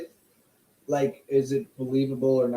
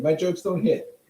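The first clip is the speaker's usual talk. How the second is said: ordinary delivery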